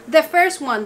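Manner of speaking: ordinary talk, nothing marked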